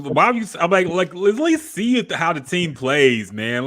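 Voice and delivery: exasperated and laughing at a fan giving up; incredulous outburst. Real, not acted. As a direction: burst out, can't believe what he's hearing. Exasperated at listener selling tickets.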